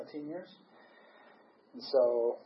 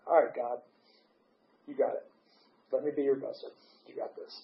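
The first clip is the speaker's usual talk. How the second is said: quiet prayer; 7 dB quieter than usual. Retold or imitated. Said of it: "and I said".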